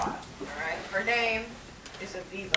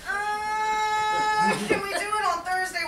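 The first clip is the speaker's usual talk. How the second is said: high, whiny hammed-up plea; sheepish pleading; 10 semitones higher, 3 dB louder, slower than usual. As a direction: drag out the uh, then plead. Pleading with his date to reschedule.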